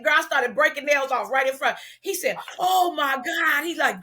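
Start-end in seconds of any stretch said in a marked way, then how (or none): 2.6-3.6 drawn-out, high exclamation; mock shock. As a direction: imitate astonishment, stretching it slowly. Boss's shocked reaction, retold.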